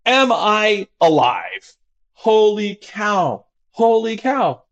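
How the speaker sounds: high, slow stunned wonder; awed patient. Retold or imitated. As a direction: marvel as if waking inside the void. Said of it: "the most important question of all time".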